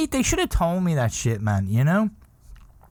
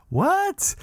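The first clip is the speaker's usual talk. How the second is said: surprised what. Retold or imitated. Head guy's baffled reply.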